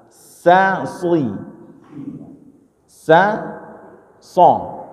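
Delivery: "Sa" light, then "sui" and "so" heavy; sin versus shad. Shown as a demonstration. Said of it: "bedakan antara sa dengan, dengan shad". (bedakan "Sa" tipis dan "so" tebal)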